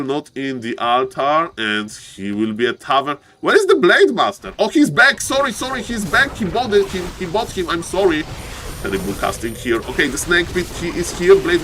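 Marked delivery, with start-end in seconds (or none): 3.4-8.2 higher, fast apologetic excitement; flustered excitement. Real, not acted. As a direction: catch yourself and apologise in a rush. Caster loses track of hero.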